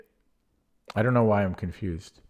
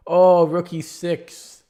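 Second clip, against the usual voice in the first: high, loud, slow dismayed discovery; excited realization; 9 semitones higher, 9 dB louder, much slower than usual. Real, not acted. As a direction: stretch out the shocked realization. Sudden realization of missed move.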